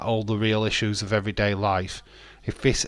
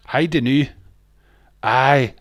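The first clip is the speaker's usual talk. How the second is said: mock Scottish, louder and higher; bad Scottish accent; 4 semitones higher, 8 dB louder than usual. Shown as a demonstration. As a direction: throw out a cheesy Scottish aye. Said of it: "I'll Scottish".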